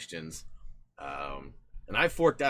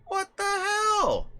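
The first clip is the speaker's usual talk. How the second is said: put-on slow, drawn-out, much higher grumbling old-man complaint; a hint of a cranky old grandpa; grumpy grandpa; 7 dB louder, much slower than usual. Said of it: "Now I sound like my grandpa".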